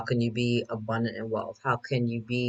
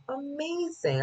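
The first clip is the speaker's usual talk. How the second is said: delighted; 14 semitones higher, 6 dB quieter, slower than usual. Retold or imitated. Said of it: "And I'm like".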